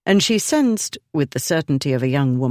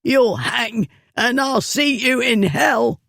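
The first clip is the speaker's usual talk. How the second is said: choking curse; 9 semitones higher than usual. A narrator's reading. Bully being choked.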